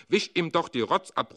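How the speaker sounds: aunt calling out. A narrator's reading. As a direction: snap it across the table. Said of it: "ruft die Tante". (Tante ruft laut und hoch)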